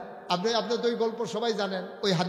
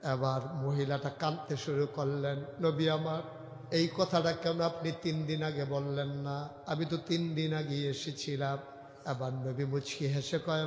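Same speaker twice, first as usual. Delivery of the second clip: soft sermon storytelling; 2 semitones lower, 6 dB quieter than usual. Acted. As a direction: tell it gently in an even lilt. Preacher intones story low.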